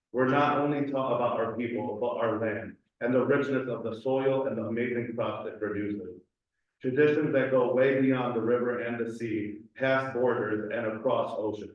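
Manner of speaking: ordinary talk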